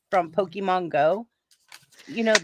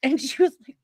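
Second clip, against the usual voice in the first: normal talking voice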